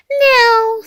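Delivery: put-on very high, loud child refusal; little Bunny. A narrator's reading. Youngest rabbit refuses sleep, high loud voice. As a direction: squeal it like a wide-awake bunny child. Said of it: "said little Bunny".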